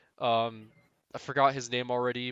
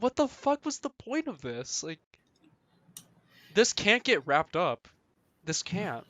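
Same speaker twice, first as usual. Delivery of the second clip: exasperated rant; 6 semitones higher than usual. Real, not acted. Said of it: "rant about chapter".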